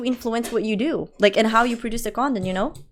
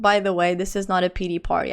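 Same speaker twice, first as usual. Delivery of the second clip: usual speaking voice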